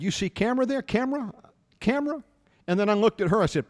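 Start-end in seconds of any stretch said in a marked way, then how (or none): 0.0-2.2 rising higher on each camera; pointing out the camera. Retold or imitated. To the pimp at his gate, high insistent broken English.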